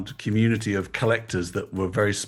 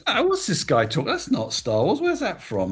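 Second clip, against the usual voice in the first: raised-pitch mock incredulity; replaying his own disbelief, higher; retold disbelief; 9 semitones higher than usual. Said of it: "I was incredulous".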